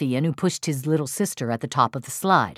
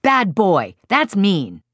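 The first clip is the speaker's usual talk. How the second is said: higher, louder telling-off; scolding attack; 4 semitones higher, 5 dB louder, slower than usual. Shown as a demonstration. Parent attacks child's character after a push, loud scolding. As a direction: scold a naughty child sharply. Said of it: "You've avoided character attacks".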